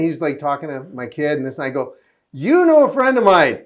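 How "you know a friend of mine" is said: higher and louder, eager to impress; excited claim. Retold or imitated. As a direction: blurt it out up high. Telling Cuba about friend.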